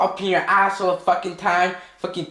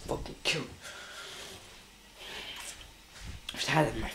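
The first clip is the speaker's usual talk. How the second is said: quiet, voiceless angry muttering; seething anger; 2 semitones lower, 7 dB quieter than usual. Real, not acted. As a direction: curse under your breath, furious. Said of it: "you just got me so mad".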